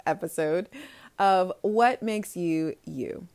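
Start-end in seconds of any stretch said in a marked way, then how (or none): none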